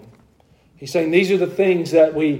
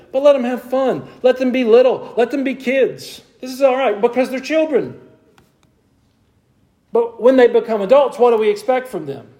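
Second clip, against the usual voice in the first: impassioned preaching; 8 semitones higher than usual. Acted. Pitch held high, pleading preaching.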